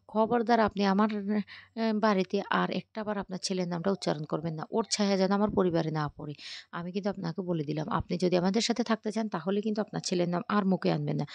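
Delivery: plain reading voice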